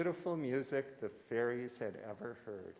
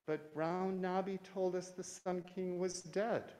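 puzzled fairy voice; 7 semitones higher than usual. Acted. Elder fairy puzzled by the news.

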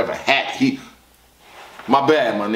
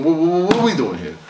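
ordinary talk

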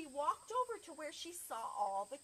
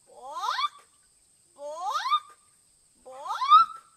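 very loud squawks climbing higher; clucking chicken; 18 dB louder, much slower than usual. Acted. Chicken asks the librarian for books, clucking loudly. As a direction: cluck the word like a hen. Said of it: "He said".

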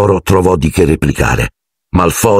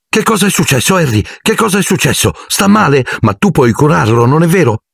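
much higher, eager chatter; excited boy; 10 semitones higher than usual. Acted. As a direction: pester Harry, breathless with curiosity. Colin excited, bouncing around. (Colin eccitato, voce acuta)

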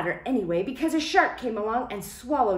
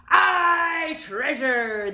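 high, loud pirate cheer; crew shouting; 7 semitones higher, 6 dB louder, much slower than usual. Acted. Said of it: "the others shouted".

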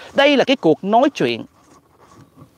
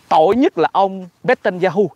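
ordinary delivery

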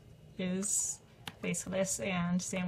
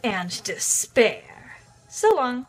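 sneering panto villain; 4 dB louder than usual. Acted. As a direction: taunt like a cartoon overlord. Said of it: "Ten out of ten voice acting".

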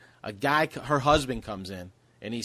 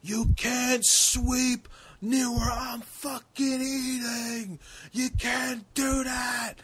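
high, slow mock ranting; imitating a yelling psycho; 10 semitones higher, much slower than usual. Comic imitating a raging man at the Dunkin counter, high slow mock rant. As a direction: play the unhinged guy in line, drawn out. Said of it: "top of his lungs".

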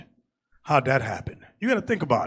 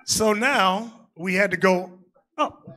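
normal talking voice

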